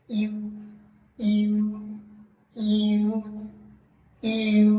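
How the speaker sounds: ইউ as a long "ee" rounding slowly into "oo"; i gliding into u. Shown as a demonstration. Teacher asking learners to notice, slow ই-to-উ glide. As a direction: hold ই, then slide into উ on one level note. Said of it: "আগে আমরা একটা রস্যই বলবো".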